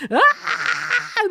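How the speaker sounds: a high, loud mock wail through laughter; high laughing wail. Wheeled down hallway, high laughing mock wail. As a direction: wail like being dragged off. Wailing down the corridor.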